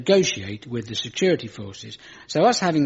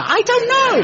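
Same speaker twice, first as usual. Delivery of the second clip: much higher, louder squeal; squeak; 6 dB louder than usual. Pretend. Comic's punchline, squeaked very high.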